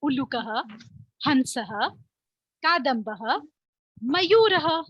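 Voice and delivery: carefully recited Sanskrit names; slow recitation. Shown as a demonstration. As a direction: say each name slowly and clearly for pupils. Teacher drills animal names in Sanskrit. (धीमे, स्पष्ट उच्चारण में पाठ)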